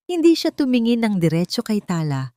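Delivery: ordinary delivery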